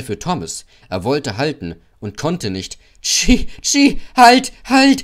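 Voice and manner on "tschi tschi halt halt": very high, loud panicked whistle cries; whistled shout. A narrator's reading. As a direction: shriek like a train whistle for a stop. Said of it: "pfiff er".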